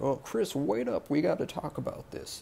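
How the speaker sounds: imitated calling after someone. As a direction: act out the mayor hailing a man who is leaving. Mayor calling after departing Chris.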